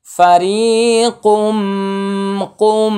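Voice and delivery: high, slow chanted tilawat; Quran recitation. Shown as a demonstration. Teacher reciting the word for students, chanted high. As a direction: sing the word out, long and high.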